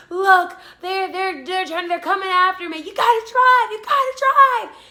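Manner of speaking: stammering high plea building to a yell; imitated panicked plea. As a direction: beg loudly, rising into frantic shouting. Imitates frantic man demanding escape.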